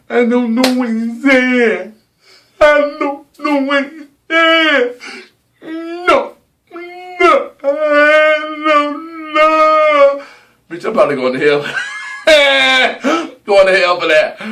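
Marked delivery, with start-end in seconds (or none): 0.1-4.9 high, slow, bewildered put-on voice; confused deaf people. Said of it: "and they're like". 5.6-10.2 high put-on pleading no; high mock no. Mock horrified refusal. 11.7-13.4 high burst of laughter. Real, not acted. Laughs at own joke.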